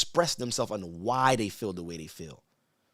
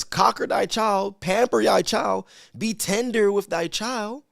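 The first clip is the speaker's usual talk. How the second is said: slow, raised preaching read; scripture reading; 7 semitones higher, 3 dB louder, slower than usual. Acted. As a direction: proclaim the verse slowly and loudly.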